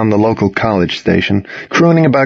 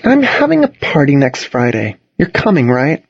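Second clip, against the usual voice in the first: cute pout; 5 semitones higher than usual. A narrator's reading. Charlotte pouting at him to come to her party. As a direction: pout it out cutely, coaxing him. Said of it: "pouting cutely".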